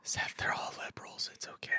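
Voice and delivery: hushed aside in a whisper; soothing whisper. Real, not acted. Co-host whispering to soothe Seth over a movie, hushed whisper. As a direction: whisper it to calm a worked-up friend. Soothing the friend trying not to scream.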